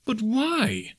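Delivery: very high, plaintive question; dismayed cry. A narrator's reading. Nephew questioning uncle's refusal, dismayed. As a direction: cry out in disbelief. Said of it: "cried Scrooge's nephew".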